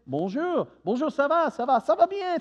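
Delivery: rising, lively French bonjour and ça va; French greeting demo. Shown as a demonstration. Professor demonstrates the polite French greeting, lively. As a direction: show how the French greet. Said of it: "You say".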